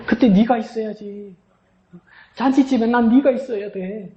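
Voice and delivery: rising, impassioned preaching as God; God calling the weary believer, building to passion; pleading voice of God. Acted. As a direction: build from tender to a high pleading cry. Preaches God's words to congregation.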